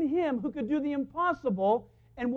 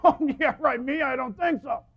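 louder, sarcastic brush-off; scoffing disbelief; 7 dB louder than usual. Retold or imitated. Sarah scoffing back at God, sarcastic. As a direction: wave it away with a scoff. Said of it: "Sarah scoffing at God's love".

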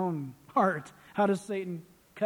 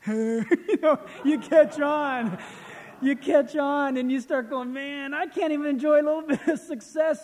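higher and louder, laughing through the complaint; exasperated laugh; 6 semitones higher, 6 dB louder than usual. Real, not acted. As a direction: laugh at your own frustration, loudly. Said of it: "laughing, exasperated at himself".

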